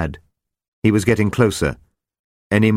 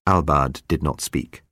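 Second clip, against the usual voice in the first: usual speaking voice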